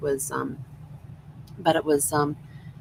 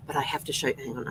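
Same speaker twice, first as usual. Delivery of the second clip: normal talking voice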